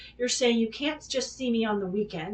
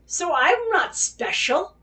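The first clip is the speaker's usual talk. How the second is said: bitter sarcasm; 5 semitones higher than usual. Real, not acted. Bitter outburst about being used.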